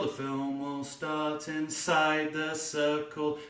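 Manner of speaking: slow, quiet, drifting thought; slow musing. Acted. Filmmaker solving the circle, slow and musing. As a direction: let it trail dreamily.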